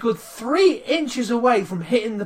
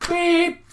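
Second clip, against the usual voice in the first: car horn beep; 9 semitones higher than usual. Retold or imitated. Imitating horn again.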